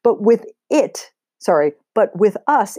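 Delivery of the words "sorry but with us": plain reading voice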